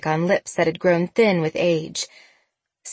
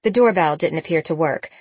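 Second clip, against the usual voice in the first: usual speaking voice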